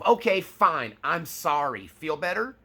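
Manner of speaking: exasperated forced apology. Retold or imitated. Narcissist pushed into apologizing, sarcastic and annoyed. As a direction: toss the apology off with irritation. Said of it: "And then they go".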